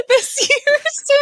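laughing through the words. Real, not acted. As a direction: giggle the words out slowly. Laughing mid-sentence about hosting.

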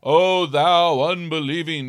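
put-on slow, solemn old-English intoning; mock solemn thee-and-thou delivery; stiff King James voice. Shown as a demonstration. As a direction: intone it like a stately scripture reading. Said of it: "I don't think he went".

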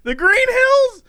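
incredulous echo. Real, not acted. Host doubting the green hills again, high incredulous. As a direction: squeak it back, not buying it. Repeating his phrase again.

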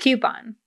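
"Coupon" said KYOO-pon, falling at the end; KYOO-pon pronunciation. Shown as a demonstration. Host confirming how she says it, "Coupon" as KYOO-pon. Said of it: "And you say coupon?".

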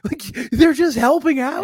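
slow, high-pitched disbelief; squeaky, drawn-out exasperation; incredulous. Retold or imitated. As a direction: stretch it out high in comic amazement. Retold disbelief at unpaid bartenders.

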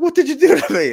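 high, loud wailing cry of the hurt friend; retelling the injured friend shouting at him, loud and high; upset friend yelling. Retold or imitated. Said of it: "And he's like".

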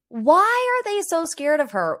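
normal talking voice

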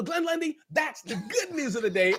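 ordinary talk, nothing marked

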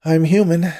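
high, loud burst of relief; elated, relieved cry; relieved exclamation. A narrator's reading. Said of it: "took in deep breaths of relief".

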